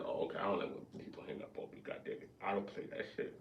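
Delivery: whispered and rushed; quick breathy whisper of a retort; a fast hushed aside; hushed retold line.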